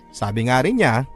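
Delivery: usual speaking voice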